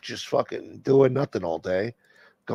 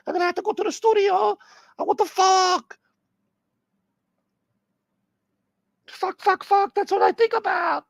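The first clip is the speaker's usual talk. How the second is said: mock J-Lo. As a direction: scold him like an angry diva. J-Lo voice again.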